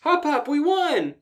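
high, slow victory cheer; thrilled kid shouting we won; excited cheer. Acted. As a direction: cheer the win. Anne celebrating.

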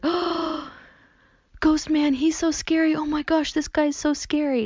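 high, loud, breathless fake fright; mock frightened squeal. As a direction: squeal like you've seen a ghost. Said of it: "pointing at him is like".

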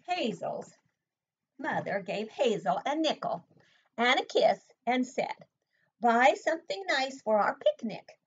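put-on animated read-aloud for young children. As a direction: read the picture book to the class with energy. Reads picture book aloud.

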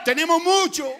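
high, loud preacher's cry, phrase after phrase; full-force preaching. Real, not acted. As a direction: preach it out in rising jubilation.

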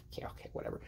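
whispered, sulky brush-off. Retold or imitated. Kid whispering back at his scolding mom, sulky. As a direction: breathe it under your breath like a sulking kid.

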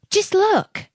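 put-on high excited shout of a boy; excited cry. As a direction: call out in delight. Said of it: "cried one".

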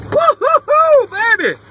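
high, drawn-out whooping; celebratory whoop. Real, not acted. Collector celebrating a pull, slow high whoop. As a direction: whoop it slowly like you hit the jackpot.

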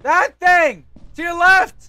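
high, loud directions; shouted warning. Real, not acted. Player points teammate to the left, urgent shout.

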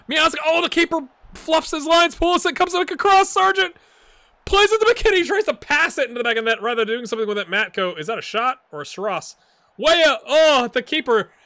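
high, loud frantic play-by-play; excited commentary. Real, not acted. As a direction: shout the action as it unfolds. Live goalmouth action commentary.

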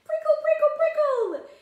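very high, squeaky little hedgehog voice; a tiny prickly creature, pitched right up. Pretend. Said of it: "hello to the hedgehog".

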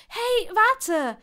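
loud, high urgent shout; calling out. A narrator's reading. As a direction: call after someone running away. Calling after fleeing animal.

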